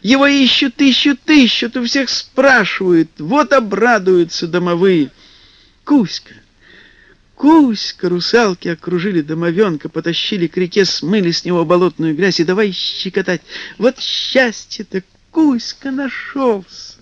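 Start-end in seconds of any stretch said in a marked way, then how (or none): 0.0-8.1 excited mermaid. Acted. Mermaid joyfully calls. 13.8-17.0 joyful. Acted. Mermaids rejoice.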